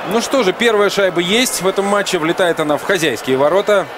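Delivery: excited hockey goal call. Real, not acted. Goal confirmed by commentator.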